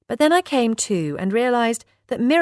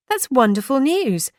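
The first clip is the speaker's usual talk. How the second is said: cheering; 7 semitones higher than usual. A narrator's reading. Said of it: "I practically cheered".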